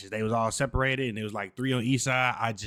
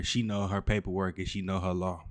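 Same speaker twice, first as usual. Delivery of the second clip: normal talking voice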